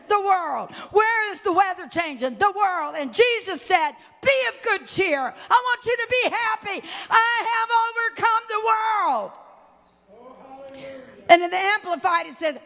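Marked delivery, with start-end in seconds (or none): 4.2-9.3 impassioned cry. Acted. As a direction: cry it out to lift the church. Preacher voicing Jesus, crying out.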